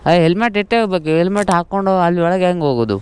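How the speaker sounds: loud protest. Real, not acted. Rider objects to a demand about his helmet, loud protest.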